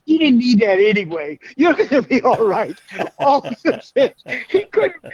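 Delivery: high, laughing through the words. Real, not acted. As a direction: tell it while cracking up. Laughing while telling story.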